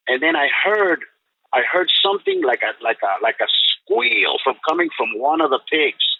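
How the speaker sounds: normal talking voice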